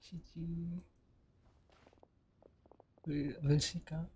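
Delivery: ordinary talk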